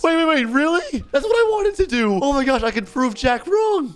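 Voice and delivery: high-pitched thrilled reaction; excited disbelief. Acted. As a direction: squeal it like your dream just got offered. Reacting to surprise movie offer.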